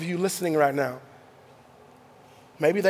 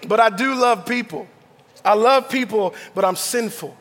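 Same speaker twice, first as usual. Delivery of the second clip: ordinary talk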